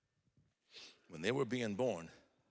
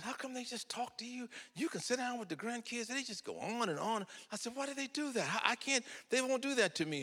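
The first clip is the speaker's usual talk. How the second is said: whiny complaint; 14 semitones higher than usual. Retold or imitated. Complaining to wife, high pitch.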